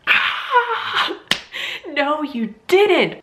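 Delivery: "Ah" shot up very high, then slow "no, you didn't"; a stunned inner cry; shocked gasp. Retold or imitated. Her inner reaction on hearing her sister's news, shocked high cry.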